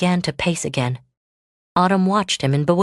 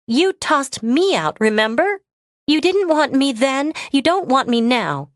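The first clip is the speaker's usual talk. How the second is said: higher, louder, hurt and accusing; hurt accusation; 9 semitones higher, 3 dB louder than usual. Acted. Autumn confronting Lucas about being tossed out, higher and louder. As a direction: throw his rejection back at him.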